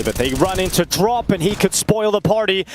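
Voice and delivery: ordinary talk, nothing marked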